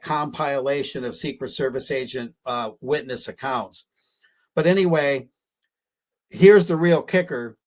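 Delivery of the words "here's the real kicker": normal talking voice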